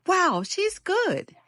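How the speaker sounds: put-on high, slow, amazed kids' voice; wide-eyed wonder, drawn out; awed children. Children admiring Molly after touchdown, high and amazed.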